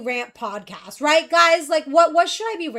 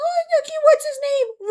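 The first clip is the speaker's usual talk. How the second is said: much higher fake-sweet voice; mock sweet question; 13 semitones higher, 5 dB louder than usual. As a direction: play the innocent baby-admirer.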